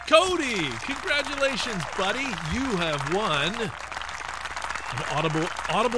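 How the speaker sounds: high, loud game show host; booming prize announcer. Pretend. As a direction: boom out the prize like TV.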